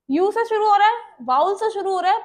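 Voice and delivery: usual speaking voice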